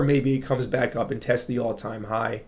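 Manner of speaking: ordinary talk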